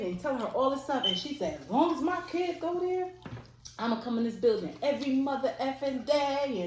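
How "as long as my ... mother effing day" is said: high-pitched, imitated defiance; defiant aunt. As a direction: act out an aunt refusing to back down. Retelling aunt's defiant comeback.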